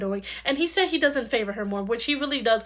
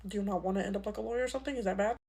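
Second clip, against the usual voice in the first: gentle; 4 semitones lower, 8 dB quieter, faster than usual. Acted. Uncle gently asks the child.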